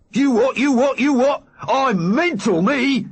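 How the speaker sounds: high, loud manic yelling; crazed skinhead charging in; mad skinhead yell. Acted. Big Mac charging the men, high manic yell.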